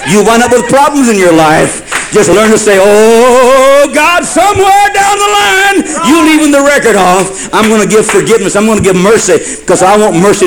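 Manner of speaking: high, driving sermon delivery; impassioned preaching. Real, not acted. Preacher urging the congregation to forgive, impassioned and high. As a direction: preach it full out, pitched high.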